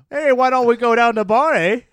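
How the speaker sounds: high, loud upper Midwest yokel voice; upper Midwest accent. Retold or imitated. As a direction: play a cheery young man with a thick upper Midwest accent. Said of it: "would have an upper Midwest accent".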